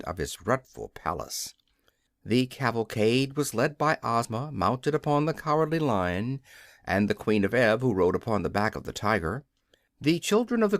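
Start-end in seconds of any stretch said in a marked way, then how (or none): none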